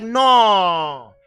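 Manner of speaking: long, loud, falling refusal; Abraham's emphatic refusal. Acted. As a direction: belt out a drawn-out refusal. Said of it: "Abraham was like".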